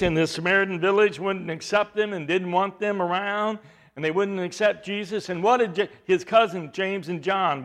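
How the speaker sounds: usual speaking voice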